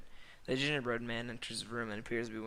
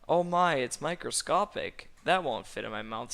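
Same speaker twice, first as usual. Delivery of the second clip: shocked Donker, lightly voiced; 3 semitones higher, 7 dB louder than usual. A narrator's reading. Donker reacting to a tiny sight, mock shock. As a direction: play up Donker's astonishment.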